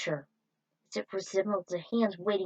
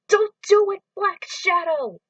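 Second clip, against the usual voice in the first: much higher, much louder pleading cry; desperate shout; 10 semitones higher, 9 dB louder than usual. Acted. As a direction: scream at him to stop. Hero pleads with villain.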